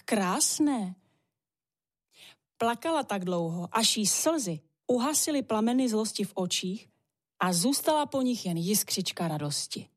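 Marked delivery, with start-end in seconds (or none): none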